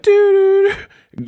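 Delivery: high "doo" dropping away; sing-song high toots trailing to nothing; imitated film sound. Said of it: "he was like".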